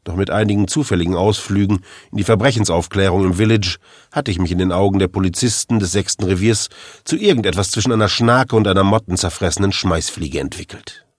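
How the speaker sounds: ordinary delivery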